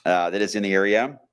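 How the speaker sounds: normal talking voice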